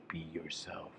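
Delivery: soft, nearly whispered imitation of advice; hushed quoted advice. Quotes family's advice to troubled person.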